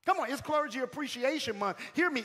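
ordinary talk, nothing marked

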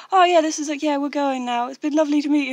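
high, loud and chirpy polite farewell; bright goodbye, a touch overdone; lightly voiced English guy answering politely; polite upbeat reply. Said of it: "and he's like".

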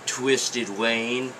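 ordinary talk

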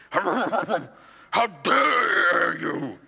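very slow, drawn-out mock outrage; lightly voiced stout priest, laughing through it; big stout priest. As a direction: stretch it out like a big stout man taking offence. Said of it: "And he".

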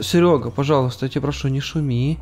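ordinary talk, nothing marked

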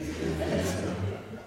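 laughing at his own joke; a hearty chuckle after the punchline; real laugh. Real, not acted.

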